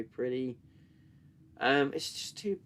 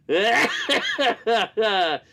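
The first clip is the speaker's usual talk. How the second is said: hearty, loud laughter; 8 semitones higher, 7 dB louder than usual. Real, not acted. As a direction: roar with laughter. Keeps laughing.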